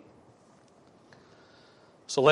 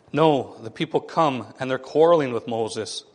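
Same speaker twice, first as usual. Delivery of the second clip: ordinary talk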